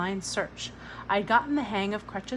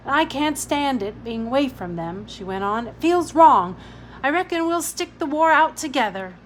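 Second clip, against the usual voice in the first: higher, louder London mother; Billy's mother; 4 semitones higher, 7 dB louder than usual. A narrator's reading. Billy's mother to Ada, loud and heartfelt. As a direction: speak as a fierce working mum.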